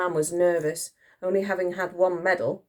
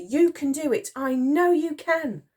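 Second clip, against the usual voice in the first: high, earnest encouragement; piping up; 10 semitones higher than usual. A narrator's reading. Girl cheering on Titch, piping up. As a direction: urge him on in a high voice. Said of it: "piped up Elsie".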